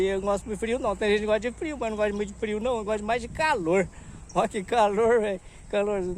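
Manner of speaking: ordinary delivery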